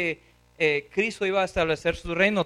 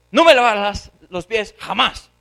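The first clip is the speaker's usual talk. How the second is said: loud, scolding outburst; angry refusal; 2 semitones higher, 7 dB louder than usual. Acted. Peter scolds Christ over the foot washing, angry refusal. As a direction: forbid it loudly, hitting "jamás". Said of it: "Le responde así".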